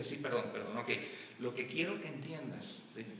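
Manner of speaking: very soft, low-pitched apology. Retold or imitated. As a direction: imitate a husband backing down in a hushed, low voice. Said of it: "tenía que bajar la voz".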